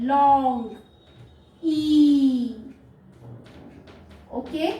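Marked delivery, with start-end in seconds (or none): 0.0-2.6 लॉन्ग ई, both drawn out, ई louder; long E. Shown as a demonstration. Teacher showing the open vowel, लॉन्ग ई stretched out. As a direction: hold ई as a long, loud "ee". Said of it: "लॉन्ग sound दे रहा है".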